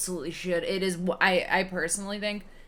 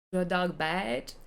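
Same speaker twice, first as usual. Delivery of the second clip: slow, drawn-out mock French accent; Jacques Clouseau French accent; much slower than usual. Retelling Clouseau asking the hotel man about his dog, in a slow mock French accent. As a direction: ask it slowly like a bumbling French inspector. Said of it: "So he's French".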